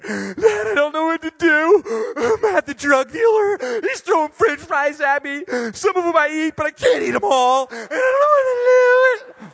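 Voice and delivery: high, loud, slow hammed-up wailing; exaggerated crybaby whine; mock sobbing. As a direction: blubber like a big baby to dad. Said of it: "I break down crying".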